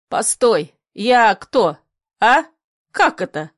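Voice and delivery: broken, indignant muttered questions; sputtering mumble. A narrator's reading. Grandfather reeling at his daughter's defiance, sputtering. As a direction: stammer out short questions, taken aback. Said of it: "забормотал".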